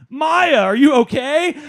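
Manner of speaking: frantic owner shouting to the dog; panicked. Retold or imitated. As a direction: call out to the dog in alarm.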